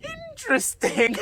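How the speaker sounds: very high, loud, slow imitation; teacher's exaggerated intrigue. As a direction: hoot it out, stretched and hammed-up. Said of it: "And she goes".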